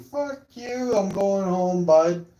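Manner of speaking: very high, drawn-out mock yell; defiant retort. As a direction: throw it back at the principal. Defiant retort to principal, acted.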